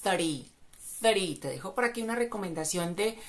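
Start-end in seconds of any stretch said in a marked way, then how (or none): 0.0-1.4 three with tongue between the teeth. Shown as a demonstration. Teacher demos the th sound in three. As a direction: push air over the tongue on three. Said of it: "con el sonido T H".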